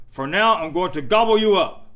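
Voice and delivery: loud, menacing troll shout. A narrator's reading. Troll threatening to eat the little goat, bellowing. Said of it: "said the troll".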